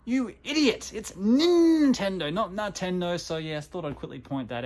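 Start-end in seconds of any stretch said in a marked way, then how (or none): 0.1-3.2 high, loud self-scolding, slow on "Nintendo"; scolding inner voice. Retold or imitated. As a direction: tell yourself off sharply, stretching "Nintendo". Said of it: "I'm realizing".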